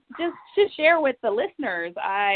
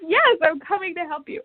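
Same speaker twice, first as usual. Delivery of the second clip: eager promise; 8 semitones higher than usual. Retold or imitated. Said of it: "she said".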